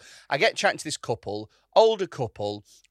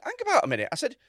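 high-pitched, incredulous telling-off; indignant retort; 8 semitones higher, 4 dB louder than usual. Retold or imitated. Comedian rounding on the heckler from Elite Singles, indignant. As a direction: re-enact an outraged comeback, pitch right up. Retelling his comeback to heckler.

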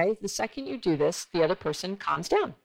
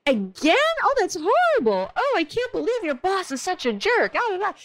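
feed the fire with mock indignation; matching their heated tone; 12 semitones higher than usual. Shown as a demonstration. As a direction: demo of the wrong, heated reply. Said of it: "you certainly don't wanna say".

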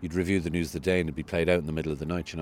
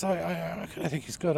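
much higher hesitant cabbie voice; cab driver; 9 semitones higher than usual. Retold or imitated. Cabbie defending the host, high and hesitant. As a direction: pitch the driver up high and unsure. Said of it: "He says".

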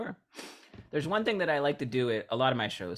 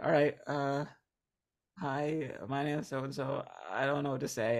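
higher, halting mock nervous speaker; uncomfortable stumbling; 4 semitones higher than usual. Nervous volunteer handed the mic, awkward and stumbling. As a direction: imitate awkwardly searching for words, with pauses. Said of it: "they'll sort of stumble through a few words".

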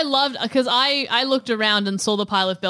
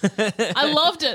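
ordinary delivery

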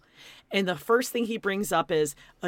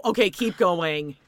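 ordinary talk

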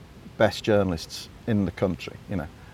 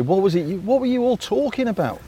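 quoting himself; 9 semitones higher than usual. Retold or imitated. Editor quoting himself to writers.

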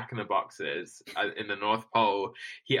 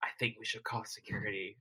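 hushed, much softer acted aside; retold whisper; 10 dB quieter, faster than usual. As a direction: drop the volume, confiding discreetly to an assistant. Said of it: "Walter whispers to his P A Deb".

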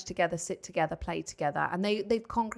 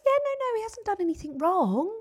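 much higher, breezy reassuring teacher voice; high sing-song teacher; 11 semitones higher than usual. Retold or imitated. Mum imitating the teacher brushing it off in a high voice. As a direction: chirp it like a cheerful teacher. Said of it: "She was like".

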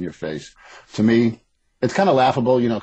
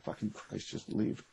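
much softer, whispered curse; under his breath; 2 semitones higher, 14 dB quieter, slower than usual. Retold or imitated. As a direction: mutter it so nobody hears. Muttering at slow shoppers.

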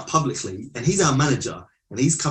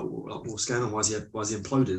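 softer, lightly voiced puzzled question; imitating puzzled dad; 4 semitones lower, 7 dB quieter than usual. Dad asks his child about the implosion, quietly puzzled. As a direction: drop the volume for dad's baffled question. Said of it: "My dad's like".

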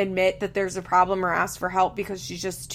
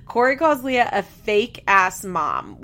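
usual speaking voice

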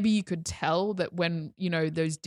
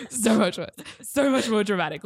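high laugh spilling into words; 3 semitones higher, 3 dB louder than usual. Real, not acted. Laughing at own drama.

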